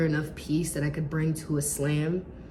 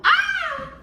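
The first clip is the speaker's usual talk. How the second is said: sudden high frustrated yelp; frustrated shriek; 4 dB louder than usual. Real, not acted. Frustrated at forgetting lines.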